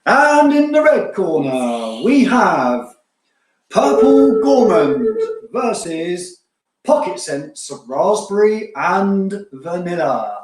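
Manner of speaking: slow, booming ring announcer build-up; boxing ring announcer. Acted. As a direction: announce it like a title fight. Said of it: "boxing announcer voice".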